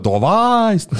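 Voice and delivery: loud, high chanted cry; mock shout. Recalling shouting with cousins in the hotel. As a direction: yell it down a hotel hallway.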